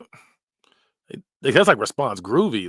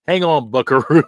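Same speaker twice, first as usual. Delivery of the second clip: loud hammed-up cowboy call; mock cowboy; 3 semitones higher, 9 dB louder than usual. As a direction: holler it like a corny cowboy. Reading cowboy-style saying.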